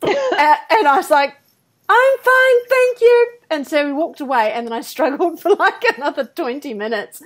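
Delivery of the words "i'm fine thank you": high, loud, breezy no thanks; chirpy fake-fine refusal; mock-cheerful refusal. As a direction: brush him off with forced brightness. Repeated refusal, same voice.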